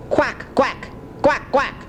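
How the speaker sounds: high, quick quacking; duck quack. Shown as a demonstration. Grandpa quacking for his grandson. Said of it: "What's a duck sound like?".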